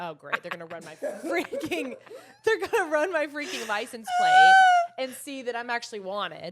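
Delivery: half-laughing dread about the license plate; panicked laughing thought. Retold or imitated. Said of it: "going through my mind was like".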